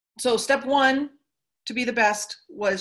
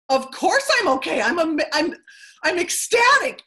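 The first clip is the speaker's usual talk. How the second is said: high, loud, gushing joy; ecstatic; 8 semitones higher, 6 dB louder than usual. Retold or imitated. Speaker retells crying joy to the dealership manager, high and loud. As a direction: retell your tearful delight, much higher and louder. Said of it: "I'm like".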